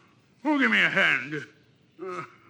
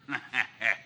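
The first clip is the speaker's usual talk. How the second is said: sly low pirate chuckle; 10 semitones lower, 9 dB quieter than usual. Acted. Silver chuckles at his offer, low and sly. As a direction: laugh softly at your own cunning.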